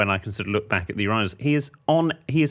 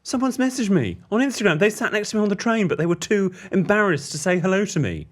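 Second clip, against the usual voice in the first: high, whiny impression of Ben; mock Ben whine; 10 semitones higher than usual. Ben complaining about fans too shy to say hello, high whine. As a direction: whine like you're desperate for fans. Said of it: "he went".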